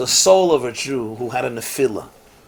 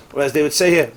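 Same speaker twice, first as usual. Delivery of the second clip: usual speaking voice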